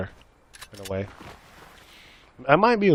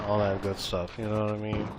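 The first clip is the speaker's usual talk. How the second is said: normal talking voice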